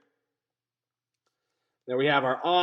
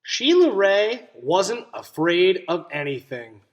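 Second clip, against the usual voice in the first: put-on reading to children. A narrator's reading. Teacher reading a story to her class, clear and expressive. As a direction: read it to the class with wonder. Teacher reads picture book aloud.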